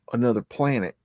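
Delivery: normal talking voice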